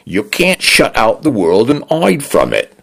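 bright friendly Cockney. A narrator's reading. The condo cheerfully lectures Dinah in bright Cockney. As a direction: soothe her brightly in Cockney. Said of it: "in a bright and friendly tone".

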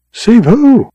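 high, incredulous question; baffled. A narrator's reading. Josefa questioning who needed saving, baffled. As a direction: pitch leaps right up in disbelief.

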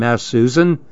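plain reading voice

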